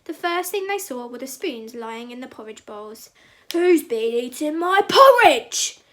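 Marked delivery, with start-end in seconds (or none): 3.5-5.8 loud, slow, booming Papa Bear cry; big grumpy bear. A narrator's reading. Papa Bear finds his porridge eaten, big grumpy voice. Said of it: "cried Papa Bear in a big, grumpy voice".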